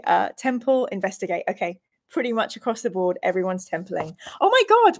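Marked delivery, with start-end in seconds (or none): 4.4-5.0 delighted gasp. Real, not acted. Sees the temple icon.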